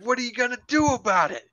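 high, rapid-fire retold challenge; challenging pit boss. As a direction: throw down a coach's challenge, acted. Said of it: "it's like".

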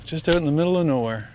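ordinary talk, nothing marked

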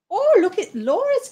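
high, lilting fake-leprechaun Irish; attempted Irish accent. Thinking of something to say to the chat, fake Irish accent. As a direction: ham up a high-pitched fake Irish brogue. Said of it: "I might be able to do, like, an Irish one".

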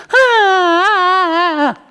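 high, loud mock crying yelps; hammed-up squeals of a pinned kid; imitated pained wail. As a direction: imitate the bully wailing in pain. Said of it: "like that".

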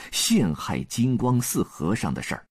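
plain reading voice

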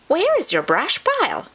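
high, squeaky little chipmunk voice; squeaking. A narrator's reading. Chipmunk to homeless possum, squeaking. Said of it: "she squeaked".

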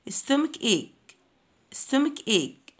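"stomach ache" slowly, k in both words. Shown as a demonstration. Teacher repeats for pupils, CH clearly said as K. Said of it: "السي والاتش بننطقهم كي".

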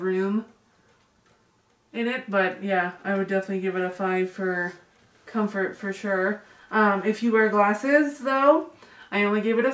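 muffled through cloth mask; speaking through a face mask. Real, not acted. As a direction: mask fabric over lips. Seen 2: Woman wears orange cloth mask over mouth, removes it near end.

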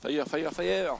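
shouting. Real, not acted. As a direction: yell it out as you shoot. Calling out while shooting bear.

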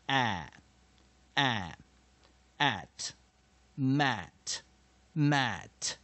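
short open "a" built up into "at", then "mat"; short A demo. Shown as a demonstration. Teacher demonstrating short vowel A, building a, at, mat piece by piece. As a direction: sound out a, at, mat with clear pauses between. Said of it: "短母音A就念a".

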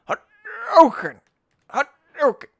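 stretched fighting-game battle cry; Hadouken cry. Pretend. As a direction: shout it like a fighting-game move.

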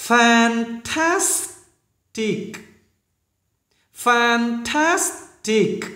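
Fantastic drawn out slowly. Shown as a demonstration. Teacher slowly modelling fantastic. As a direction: stretch every syllable of Fantastic. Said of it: "những chữ hơi khó phát âm".

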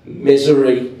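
low, loud goth deadpan; chief goth. Acted. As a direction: answer deep and heavy, like a goth. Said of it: "went up to the chief one".

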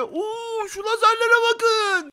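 high, drawn-out wonder; amazed exclamation. Pretend. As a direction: stretch it out in high-pitched awe. Discovers laser obstacles. (hayretle, uzatarak)

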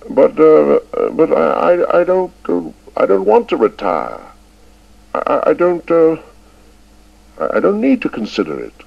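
put-on high, stammering old man flaring up; flustered old schoolmaster. A narrator's reading. Old Chips stammering at the headmaster, flustered and high. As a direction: sputter it out, caught off guard. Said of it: "Abruptly, Chips flamed up".

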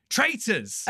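sudden high blurt of the name; excited nudge-shout. Retold or imitated. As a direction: blurt it out, thrilled. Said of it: "You nudged me and went".

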